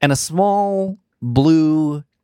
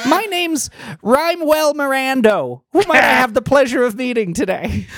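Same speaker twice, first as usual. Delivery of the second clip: pitched way up, wistful little fairy; high fairy voice; 10 semitones higher than usual. Acted. GM redoing the fairy's line in the right high voice. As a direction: redo it high and sighing.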